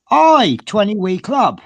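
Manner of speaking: a light imitation of the Scottish woman at the counter; Scottish shop assistant. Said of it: "And she just goes".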